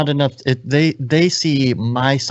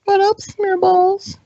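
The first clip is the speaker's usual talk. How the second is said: much higher, slow goofy greeting; silly high voice; slower than usual. Acted. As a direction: squeak it out like a cartoon. Mock intro greeting.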